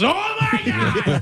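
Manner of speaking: high, slow, drawn-out Sandler wail; laughing Sandler-style gasp at a tall man; Adam Sandler voice. Retold or imitated. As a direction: stretch it like Sandler in awe. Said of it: "does the perfect Adam Sandler".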